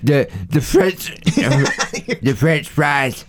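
Sling Blade impression. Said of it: "done for Sling Blade".

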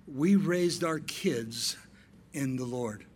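slow, weighty, each word set apart; slow, solemn preaching cadence; slow emphatic preaching. Shown as a demonstration. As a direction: lay it down slowly for the church. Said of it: "paced preaching".

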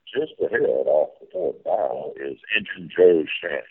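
folksy tour-guide captain calling the sights; riverboat captain spiel. Pretend. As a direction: play the steamboat captain giving the tour.

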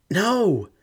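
high, loud anguished cry; anguished scream. Acted. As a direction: scream out in despair. She sees ring thrown.

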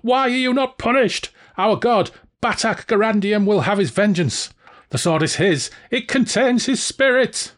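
high, outraged villain voice; Valjean, unbelieving. A narrator's reading. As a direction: sputter in disbelief, pitched high. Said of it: "Valjean said unbelievingly".

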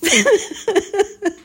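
loud high giggle; a sudden squeal of laughter; real laughing fit; high burst of laughter. Real, not acted. Breaking up while reading.

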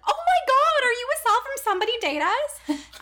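high, gushing fan squeal, acted; starstruck fan. Imagined stranger spotting a podcast host, squealing with excitement.

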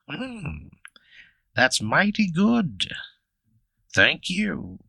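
high, slow, swooping creature voice; Bigfoot voice. Acted. Bigfoot thanks the player for jerky, slow high creature voice. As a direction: savour it slowly in a high creature voice. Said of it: "He chews on it and he says".

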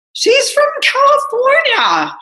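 very high, drawn-out, slow exclamation; exasperated emphasis. Real, not acted. Telling viewers the producer isn't foreign, slow high exasperation. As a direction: stretch it out in mock outrage. Exasperated aside about accents.

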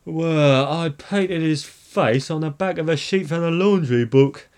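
high character voice, loud and alarmed; Minister of Education voice. A narrator's reading. Alarmed minister's acted aside, said whispered.

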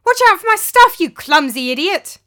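very high, very loud yelling insult; furious shout. Acted. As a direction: scream at the servant. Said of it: "looking up in anger".

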